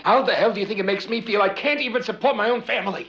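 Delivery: husband and wife fight about his lost job, angry; angry argument. Acted. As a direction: fire back at each other in anger. Heated marital argument over work.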